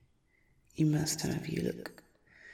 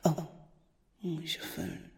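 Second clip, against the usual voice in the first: normal talking voice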